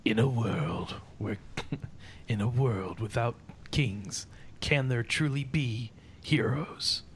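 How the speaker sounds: slow, hushed epic announcer; movie trailer voice. Shown as a demonstration. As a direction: tease it like a blockbuster trailer. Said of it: "I'm not good at the movie trailer voice".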